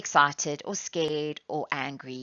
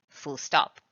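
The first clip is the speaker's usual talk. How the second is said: ordinary talk, nothing marked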